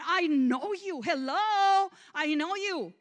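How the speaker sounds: loud, high, defiant insistence; still mad. Retold or imitated. Still mad, telling God she grew up in church, loud and defiant. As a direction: insist you know him, furious. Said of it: "I was still mad and I said".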